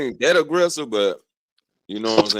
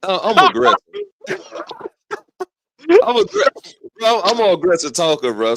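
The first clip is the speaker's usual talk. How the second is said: loud admission; 10 semitones higher, 6 dB louder than usual. Real, not acted. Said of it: "Nah, let me stop lying".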